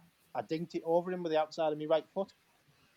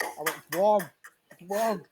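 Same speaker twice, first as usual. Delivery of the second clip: mock urgent warning; 5 semitones higher, 8 dB louder, slower than usual.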